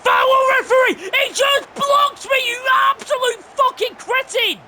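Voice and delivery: very high, loud tirade at the referee; furious shout. Real, not acted. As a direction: scream abuse at a blind referee. Raging at referee over a block.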